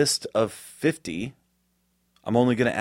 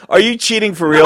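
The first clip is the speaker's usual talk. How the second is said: higher and louder, laughing through it; laughing accusation; 10 semitones higher, 10 dB louder than usual. Real, not acted. A friend keeps guessing right, asks through laughter if he cheats. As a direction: accuse him while cracking up.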